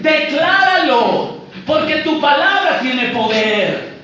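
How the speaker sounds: high, loud, drawn-out mock proclamation; mock pulpit shouting, stretched endings; mimicked declaration preacher. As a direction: imitate a hyped-up preacher commanding declarations. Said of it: "hay muchas pseudoiglesias donde dicen".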